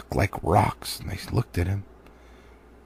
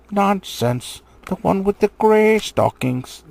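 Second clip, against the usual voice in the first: high, louder troll contradicting; mimicked troll voice; 8 semitones higher, 5 dB louder, slower than usual. Wizard mimicking William to start a quarrel. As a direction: imitate William, pitched up. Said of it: "said a voice like William's".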